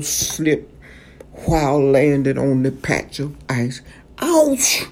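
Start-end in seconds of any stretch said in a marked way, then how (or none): 4.2-4.8 yelped high, pained surprise; a sharp high yelp as he slips; startled cry of pain; sudden ouch. Acted. Owl slips on ice.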